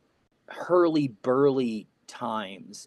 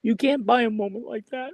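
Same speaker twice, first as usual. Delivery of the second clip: high, strained voice fighting tears; real tearful catch in the throat; choked up; 7 semitones higher, 3 dB louder than usual. Real, not acted. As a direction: force the words out between long pauses. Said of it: "I'm getting a little choked up".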